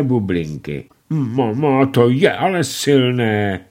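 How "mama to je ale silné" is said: nasal wonder. A narrator's reading. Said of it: "huhňal s podivem".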